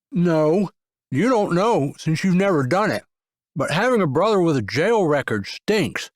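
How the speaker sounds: words around a bite of burger; mouth full. A narrator's reading. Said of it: "his mouth full".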